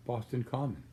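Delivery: plain reading voice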